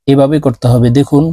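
ordinary talk, nothing marked

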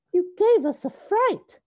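high, loud exclamation; little sisters' voices. A narrator's reading. Sisters scold after a scare, high loud little voices. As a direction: squeal it in mock alarm. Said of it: "said the sisters".

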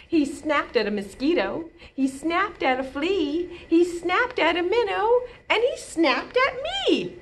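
rising nursery-rhyme suspense; building rhyme. Pretend. Turtle rhyme, pitch climbing each line.

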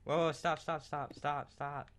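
hurried high calls to stop; urgent calling out. Real, not acted. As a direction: call out fast to make them stop. Friend misplacing blocks, calling out.

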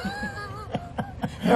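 high bright burst of laughter. Real, not acted. Host laughing at her jab about the draft. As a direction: laugh out at your own punchline.

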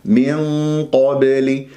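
"من قبل" with dark held nasal and heavy qa; matched heaviness. Shown as a demonstration. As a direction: thicken both the hum of "من" and the q of "قبل". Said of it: "إذا نفس الشيء لازم يكون".

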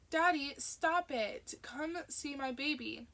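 put-on high, slow little-girl protest; a small girl squealing at her dad's raspberry; giggly toddler. A narrator's reading.

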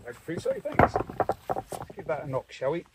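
ordinary delivery

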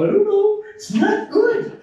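high giggly "I don't know", sheepish and helpless; mock clueless answer. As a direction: play the caught-out believer who has no answer, giggling.

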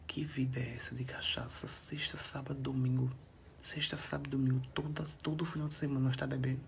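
hushed whisper to the camera. Real, not acted. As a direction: whisper it like a secret. Whispered hungover musing.

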